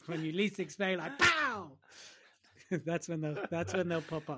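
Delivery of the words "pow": high, explosive "pow"; sudden pow. Pretend. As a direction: burst out with a surprise bang. Imitates secrets popping up suddenly.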